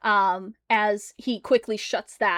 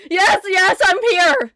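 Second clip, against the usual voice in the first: loud, higher near-crying answer; panicked reply; 9 semitones higher, 8 dB louder than usual. Acted. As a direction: gasp it out in panic.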